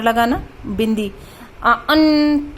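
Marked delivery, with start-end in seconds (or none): 1.6-2.5 "आ, अन" said slowly with the अन pushed through the nose; nasal demo. Shown as a demonstration. Teacher to students, demonstrating anusvar: slow nasal अन. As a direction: stretch "अन" out and let it ring nasally. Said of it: "थोड़ा नासिका से आवाज़ आती है".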